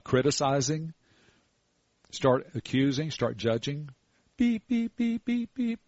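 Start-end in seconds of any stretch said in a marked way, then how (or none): 4.4-5.8 high mock electronic beeps; quick high beep beep beep; beeper beeps. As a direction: chirp like a little alarm. Imitating the creature beeper.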